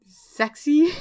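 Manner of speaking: giggled, loud, high "Sexy?"; embarrassed laughing question. Real, not acted. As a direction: blurt it out through a laugh.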